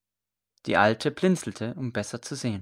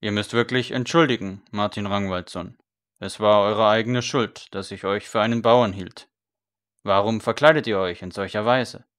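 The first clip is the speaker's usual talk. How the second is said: gruff priest; 2 semitones lower, 6 dB louder than usual. A narrator's reading. Priest blaming the scribe for his disguise, gruff. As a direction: excuse yourself, then blame him.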